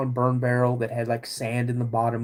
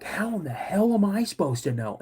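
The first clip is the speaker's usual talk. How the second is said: higher-pitched mock exasperation; exasperated retort; 7 semitones higher than usual. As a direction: act out a boy fed up with dad. Startled boy snaps at dad.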